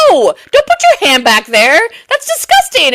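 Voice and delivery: high, loud disgusted shriek; loud disgusted squeal. Retold or imitated. Disgusted refusal.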